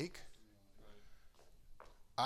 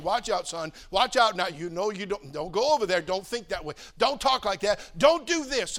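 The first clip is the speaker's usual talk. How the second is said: stern warning; 3 semitones higher, 10 dB louder than usual. Retold or imitated. God's warning signs voiced.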